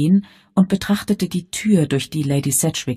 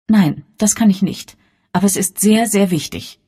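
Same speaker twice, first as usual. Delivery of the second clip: raised, emphatic insistence; changed, earnest tone; 5 semitones higher, 4 dB louder than usual. A narrator's reading. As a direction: refuse firmly, then press how much it matters. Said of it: "Elviras Ton hatte sich so verändert".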